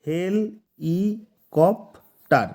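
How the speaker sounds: হেল, ই, কপ্টার separated by long pauses; syllable by syllable. Shown as a demonstration. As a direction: break it up as হেল – ই – কপ – টার. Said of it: "চারটা syllable দিয়ে গঠিত হয়েছে".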